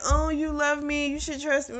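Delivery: a sing-song mock of a lover's plea; mocking sweet talk. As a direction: sneer at the sugary line by parroting it. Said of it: "So I'm not with that".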